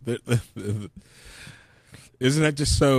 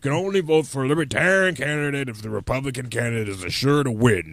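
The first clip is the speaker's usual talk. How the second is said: lightly voiced grumbling old boomer; 4 semitones higher, 3 dB louder than usual. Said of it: "I like your boomer voice".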